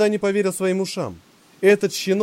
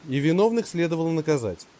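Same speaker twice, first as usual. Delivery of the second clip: usual speaking voice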